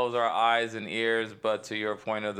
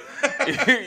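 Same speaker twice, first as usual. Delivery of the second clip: high, loud burst of laughter; 12 semitones higher, 5 dB louder than usual. Real, not acted. Comedian amused by his colonialism joke, laughing. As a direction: crack up at your own joke.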